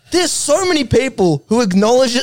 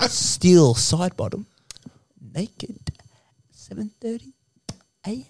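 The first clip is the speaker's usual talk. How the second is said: creepy hushed caller; 6 dB quieter than usual. Retold or imitated. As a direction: breathe it down the line like a dodgy invitation. Jared Waitley being like.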